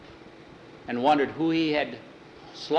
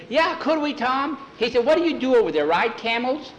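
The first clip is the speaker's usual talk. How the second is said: put-on much higher, excited kid-like voice; eager young cowhand; 11 semitones higher, 4 dB louder than usual. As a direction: pipe up high and eager like a boy. Young cowhand Skeeter eagerly asks.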